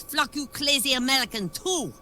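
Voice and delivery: mock Japanese accent. Tourist cursing the exchange lady in a fake Japanese accent. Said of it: "Man goes".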